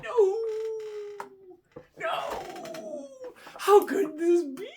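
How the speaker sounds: high, drawn-out wail; mock despair. Bidder thinking he lost, hammed-up despair. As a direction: wail it like a melodrama loser.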